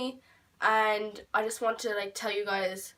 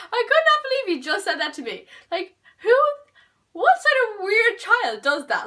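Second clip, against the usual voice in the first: loud high laughing outburst; half-laughing, much higher and louder rant; laughing disbelief; 11 semitones higher, 10 dB louder than usual. Real, not acted. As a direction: laugh through it, still not believing it.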